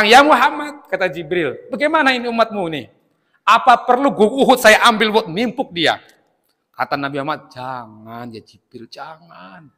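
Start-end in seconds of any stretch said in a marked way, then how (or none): 0.0-0.8 high, loud indignant call; exasperated. Acted. Jibril arriving to address the Prophet, exasperated. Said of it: "Jibril pun nyampe jengkel". 1.7-6.0 high-pitched acted indignation; exasperated. Jibril offering to punish the Prophet's people, exasperated. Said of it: "Jibril pun nyampe jengkel". 7.5-9.7 hushed, drawn-out plea; gentle restraint. Acted. Prophet restraining Jibril from punishing his people, gently.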